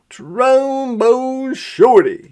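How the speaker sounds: high, slow call of the nickname. Acted. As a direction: call it out across the street, proud. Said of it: "he called out".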